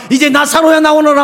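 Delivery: loud, high commanding call; ringing preacher's command; Jesus calling Lazarus. Acted. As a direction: call the dead man out of the tomb. Jesus calls Lazarus from tomb.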